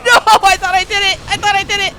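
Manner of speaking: loud, high wailing complaint; real yell of disappointment; frustrated yell. Real, not acted. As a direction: yell it out in disbelief. Boss fight lost, frustrated yelling.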